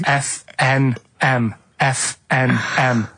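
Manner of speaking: slow, deliberate letter by letter; slow spelled out. Real, not acted. Host spelling the initials slowly for listeners.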